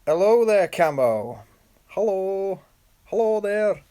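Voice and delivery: badly faked high Scottish greeting; failed Scottish accent. Live streamer greeting a viewer in a botched Scottish accent. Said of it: "I tried to do a Scottish accent then".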